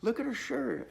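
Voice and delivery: a friend shrieking to point out a shirt; retold excited screaming. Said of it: "Angela starts screaming".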